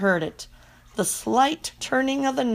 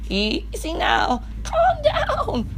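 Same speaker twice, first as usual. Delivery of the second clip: slow, high squeaky hamster voice, trying to calm; soothing squeak; much slower than usual. Acted. Hamster calming the big dog with a soothing squeak. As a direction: coax the beast in a tiny squeak. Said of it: "I squeaked soothingly at the beast".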